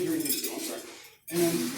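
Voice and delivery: usual speaking voice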